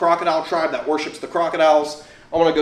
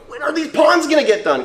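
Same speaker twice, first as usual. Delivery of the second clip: exasperated; 11 semitones higher than usual. Retold or imitated.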